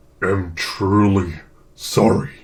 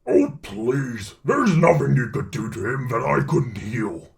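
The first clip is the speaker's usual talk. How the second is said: loud, scoffing symbiote; dismissive monster retort; 5 dB louder than usual. Acted. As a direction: brush off the threat with scorn. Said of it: "Venom scoffs".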